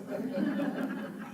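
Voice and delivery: breathy rising chuckle; soft laugh. Real, not acted. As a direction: laugh at yourself. Laughing at own confession.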